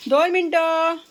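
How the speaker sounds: shouted reply across the house; calling out. Real, not acted. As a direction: holler for a moment's patience. Calls out for two minutes' wait.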